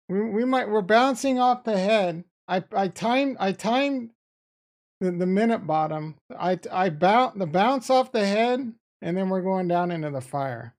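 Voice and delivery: loud, fast-paced excited rant; hyped about the trade. Real, not acted. Excited trading commentary.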